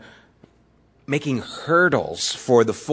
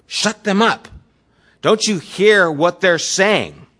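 higher, indignant demand to silence them; irate leaders; 6 semitones higher, 4 dB louder than usual. Retold or imitated. Temple leaders demanding Jesus silence the kids, indignant. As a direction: snap it out as offended priests.

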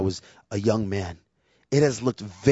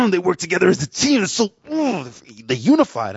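much higher, teary mock gush over the movie; mock crying; 9 semitones higher, 5 dB louder than usual. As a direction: ham up a grown man weeping at a film. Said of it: "I'm here sitting crying".

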